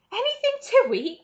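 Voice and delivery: high, loud delighted question; cried laughing. Acted. Charlie answers Grandma Josephine, high and delighted. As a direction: laugh it back at her. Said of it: "cried Charlie, laughing".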